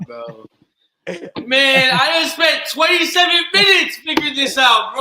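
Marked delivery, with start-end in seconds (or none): 1.5-5.0 loud, high exasperated laughing; laugh-yell in frustration; laughing shout. Real, not acted. Frustrated laughing outburst at mic.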